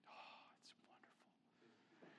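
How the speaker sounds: breathy, drawn-out whisper of delight; blissful whisper. Acted. Preacher relishing cheese pouring onto his salad, blissful whisper. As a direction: savor it in a slow, hushed whisper.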